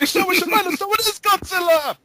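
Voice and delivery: high, loud movie-extra scream; mock panicked shout. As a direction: cry out like a fleeing citizen.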